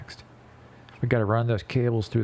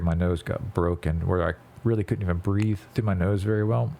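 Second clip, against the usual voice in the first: normal talking voice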